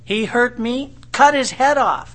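high and loud, demanding revenge; mock vengeful outburst. Vengeful man demanding revenge, mock angry outburst. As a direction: play the angry man who wants a head for an eye.

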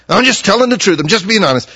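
loud, high, indignant "just being honest"; mad self-justifying. Retold or imitated. Imitating an angry person defending their bluntness, loud and indignant. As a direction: mimic someone who's mad insisting they're honest. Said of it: "who's mad".

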